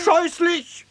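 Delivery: disgusted. Acted. Reacting to the child's song.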